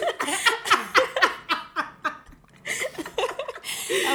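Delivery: high, loud burst of laughter. Real, not acted. Laughing at her joke.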